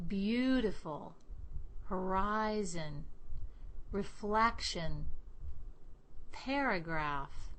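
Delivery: each word slow, holding the vowel of the stressed syllable; lengthened stressed vowels. Shown as a demonstration. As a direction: stretch the stressed vowel in every word, with a pause between words. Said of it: "Really hold the vowel of the stressed syllable".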